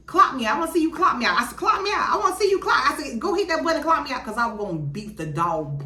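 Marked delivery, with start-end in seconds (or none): 0.1-1.6 escalating dare. Retold or imitated. Daring manager in argument. 1.6-2.1 pitched high, taunting; rising taunt at the manager; heated dare. Retold or imitated. Repeated much higher. 2.1-4.3 escalating dare. Retold or imitated. Daring manager in argument.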